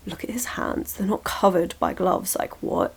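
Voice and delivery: scandalised half-whisper, barely voiced; acting quietly aghast; hushed mock shock. Mock shock at bare hand.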